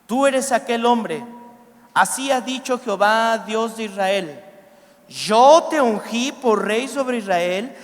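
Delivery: loud, emphatic scripture proclamation; lectura bíblica predicada. A narrator's reading. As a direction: proclaim God's rebuke at full preaching force. Reads scripture at full force. (lectura bíblica proclamada con fuerza)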